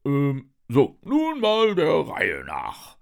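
slow, loud, pompous police sergeant; Wachtmeister Wackelzahn. A narrator's reading. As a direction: take charge with drawn-out official importance. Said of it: "Wachtmeister Wackelzahn wendet sich". (lauter, gedehnter Polizistenton)

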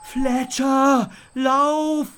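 Theo, fleeing, yells to Fletcher to run, loud and high; yelling. A narrator's reading. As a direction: scream a warning over your shoulder. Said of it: "brüllte er zu mir".

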